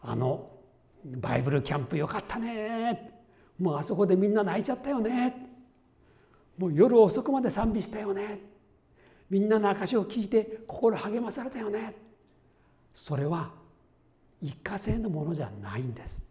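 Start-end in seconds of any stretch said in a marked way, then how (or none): 1.0-12.0 high, eager recalling of camp; excited youth reminiscing. Retold or imitated. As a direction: gush like a teen after camp. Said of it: "若い人で言うならば". (キャンプを懐かしむ若者の弾んだ口調)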